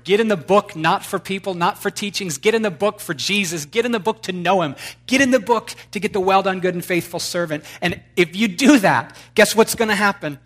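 loud, high preacher's cry; rousing pulpit exhortation; fiery preaching. Acted. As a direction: build them up into a shout. Preaching at full force.

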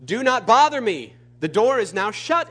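acted annoyed householder turning someone away; irritated refusal. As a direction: refuse firmly, high and loud at first. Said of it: "he will answer from within".

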